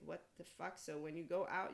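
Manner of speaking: ordinary talk, nothing marked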